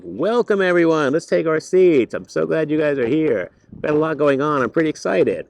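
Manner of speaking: high, loud beaming class greeting; cheerful teacher calling the room to order; big excited welcome. Retold or imitated.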